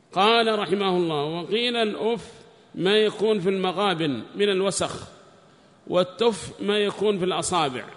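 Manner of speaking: high, loud recitation of a text; declaimed scholarly reading; reading aloud. Acted. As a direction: read it out loudly, pitched well up. Reads the book aloud.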